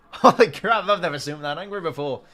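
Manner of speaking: real laughing exclamation. Real, not acted. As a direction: laugh through it in amazement. Laughing at the angry player.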